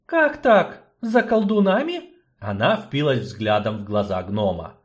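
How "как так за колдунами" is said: high, startled disbelief; startled sorceress. Acted. Sorceress hears of witch hunt, startled. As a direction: sorceress jolted, voice leaps up. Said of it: "Эльтара вздрогнула".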